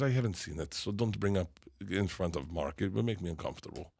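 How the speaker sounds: put-on Croatian accent. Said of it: "Eva, who's from Croatia".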